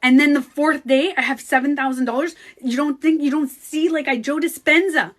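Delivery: fast, loud, high indignant excitement; why aren't you excited?; retold disbelief. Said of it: "I was like".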